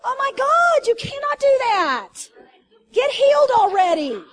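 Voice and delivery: loud and high, slowed on the rebuke; preaching shout. Real, not acted. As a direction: shout it at the whole congregation.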